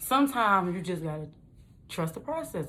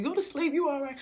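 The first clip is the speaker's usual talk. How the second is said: put-on coaxing like a baby; 8 semitones higher than usual. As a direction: coo her to sleep like a baby. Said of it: "like a little baby".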